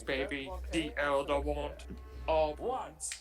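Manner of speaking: normal talking voice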